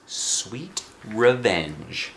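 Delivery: ordinary delivery, nothing marked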